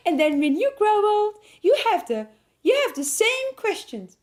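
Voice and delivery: put-on high, laughing old-lady voice; giggly mock grandma. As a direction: keep up the grandma through giggles. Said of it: "she says".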